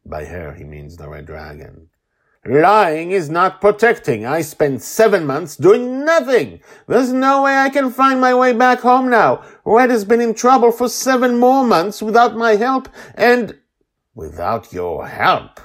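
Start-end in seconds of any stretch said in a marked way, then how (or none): 2.4-13.6 high, loud, relentless tirade; enraged shouting. A narrator's reading. Joy rages at the Guardian for wasted months, enraged shouting. 14.2-15.6 loud, slow scornful echo; derisive. A narrator's reading. Guardian cuts in on Joy's outburst, derisive. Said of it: "the Guardian says derisively".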